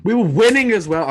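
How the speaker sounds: loud, high-pitched protest; real exasperated shout; loud outburst. Real, not acted. Sudden outburst about losing final.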